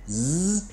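z held as a voiced buzz; buzzing z sound. Shown as a demonstration. Teacher buzzing z for students. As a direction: hum the z out clearly. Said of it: "Um é tipo z".

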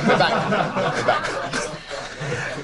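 laughing through the words. Real, not acted. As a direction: chuckle as you repeat it. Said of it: "laugh returns".

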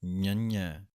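ඤੰඤੈ said as nyə-nyay with palatal nasals; nasal nyanye. Shown as a demonstration. Teacher showing Gurbani pronunciation of ඤੰඤੈ. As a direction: sound ඤੰඤੈ with both n's as ny. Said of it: "ਇਸ ਤਰ੍ਹਾਂ ਇਹਦਾ ਉਚਾਰਨ ਹੈ".

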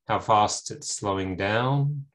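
ordinary talk

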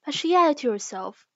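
ordinary delivery

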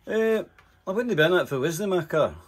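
cheeky retort. Retold or imitated. His cheeky retort to cop.

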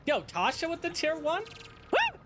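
high-pitched hype shout-out with a woo; excited hype. Real, not acted. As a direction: celebrate a new sub, voice leaping up high. Streamer hypes up a new subscriber.

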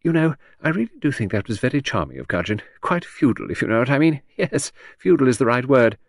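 breezy, lightly amused aristocrat; conversational. A narrator's reading. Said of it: "She said conversationally".

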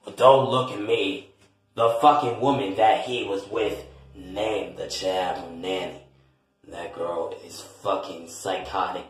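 low, grumbling relative voice; defensive older relative. Acted.

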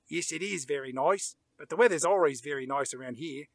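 lightly imitated Australian accent. Film's beach extra chatting with Brian about weather, light Australian accent. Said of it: "says in an Australian accent".

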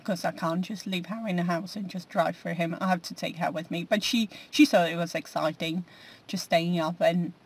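usual speaking voice

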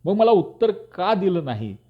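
high, angry demand; almost shout the question like someone losing control; near shout. A narrator's reading. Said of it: "Pant जवळजवळ ओरडले".

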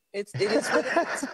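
chuckling as the punchline lands; amused at the irony of donating to the US from Africa, laughing; laughing through it. Real, not acted. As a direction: let a laugh break through the words. Laughs at own ironic joke.

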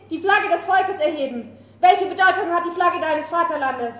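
rising from firm to furious shouting; speech that swells into rage; building fury. Acted.

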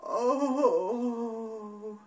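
loud tired groan; weary groan. Acted. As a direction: groan like an exhausted daddy bear. Daddy Bear tired groan.

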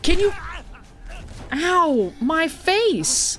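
high, strained yelps of pain; mock pained yelping. Player being punched in the game, pained yelping. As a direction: yelp as if each blow lands.

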